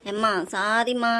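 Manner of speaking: slow, drawn-out sorry; pleading apology. Acted. Boy begging mother's pardon over snack, pleading.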